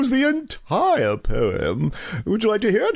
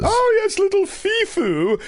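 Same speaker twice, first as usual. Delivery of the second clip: gushing dog owner; 9 semitones higher than usual. Acted.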